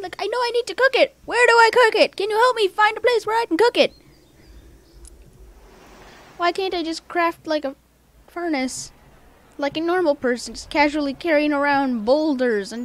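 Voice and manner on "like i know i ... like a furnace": high, loud frantic begging; raised, urgent, pitched-up protest; exasperated plea. Real, not acted. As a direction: cry out for help in frustration.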